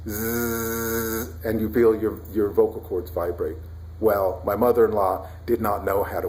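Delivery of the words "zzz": a soft buzzing zzz like a bee; buzzing z sound. Shown as a demonstration. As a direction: buzz a long z. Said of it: "Z is a voiced S".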